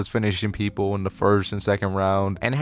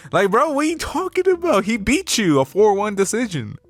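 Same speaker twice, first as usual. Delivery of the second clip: much higher, disbelieving bro; incredulous; 9 semitones higher than usual. Real, not acted. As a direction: pitch jumps up in plain disbelief. Incredulous at Garry's claim.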